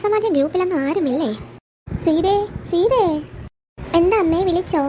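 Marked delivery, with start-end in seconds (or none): 2.0-3.0 slow drawn-out call across the house. Acted. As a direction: call her name slowly from another room. Mother calls daughter-in-law. (നീട്ടി വിളിക്കുന്നു)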